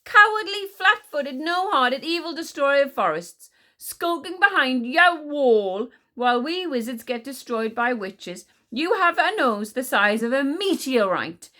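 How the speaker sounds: high, loud hurling of insults; angry shout. A narrator's reading. Boy wizard insulting the witch-hating queen, shouting furiously. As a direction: shout it out, building to the last insult. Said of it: "shouted Tsar".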